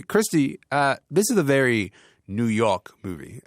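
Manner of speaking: a lightly put-on New York accent, barely there. Shown as a demonstration. Host trying a New York accent on his co-host. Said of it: "I'm trying to do a New York accent".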